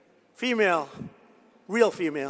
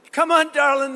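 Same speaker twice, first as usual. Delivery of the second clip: mock cheering mother; 6 semitones higher, 3 dB louder than usual. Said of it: "the mother was screaming".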